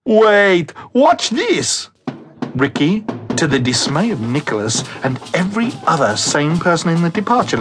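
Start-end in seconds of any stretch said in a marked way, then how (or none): 0.0-1.9 eager shout. Acted. Ricky calls out eagerly.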